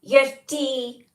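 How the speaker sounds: high, loud exaggerated Scottish accent; hammed-up Scottish shout. Shown as a demonstration. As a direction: belt it out in a mock Scottish accent. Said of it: "You say it in a Scottish accent".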